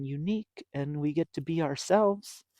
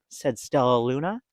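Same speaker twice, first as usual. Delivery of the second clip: plain reading voice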